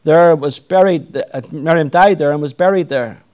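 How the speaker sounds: measured, solemn Bible reading; scripture reading. Acted. Preacher reading Numbers to the congregation, solemn and measured. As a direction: read the King James passage steadily aloud.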